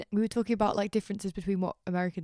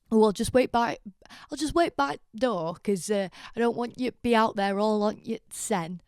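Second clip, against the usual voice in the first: loud mock Sheffield accent, raised pitch; 4 semitones higher, 6 dB louder than usual. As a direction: imitate a broad Sheffield man. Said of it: "that's the most Sheffield sentence".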